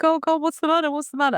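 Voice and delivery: high, loud, alarmed "what's the matter"; worried boss. Retold or imitated. Senior leader chasing a crying colleague out of the room, high and alarmed. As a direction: rush after her, anxious and concerned.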